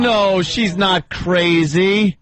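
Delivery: high, drawn-out caller impression; exaggerated high mimicry of an indignant granddaughter; imitating her voice. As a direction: drag it out high, mocking the girl. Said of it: "I gotta get that voice down".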